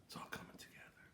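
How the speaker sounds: still, whispered wise mentor; calm whisper. Retold or imitated. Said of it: "with the calmness and the stillness".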